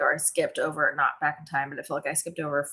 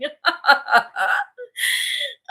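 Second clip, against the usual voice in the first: high, teary laugh; helpless high laughter; 3 dB louder than usual. Real, not acted. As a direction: laugh until it almost turns to crying. Laughs at her own joke.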